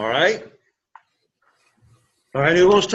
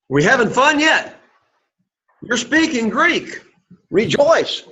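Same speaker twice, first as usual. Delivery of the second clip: much higher, teasing encouragement; playful cheer; 8 semitones higher than usual. Real, not acted. Teacher cheering on a struggling Greek class, high and playful. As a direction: lift their spirits, pitch up.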